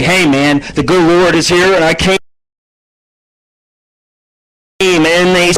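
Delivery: high, excited showman voice; mock stage boast. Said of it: "grab the microphone and say".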